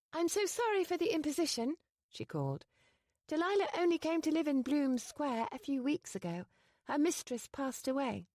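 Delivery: put-on young lady apologizing to the duke; Penny's voice. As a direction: apologize in a flurry. Penny's dialogue read high.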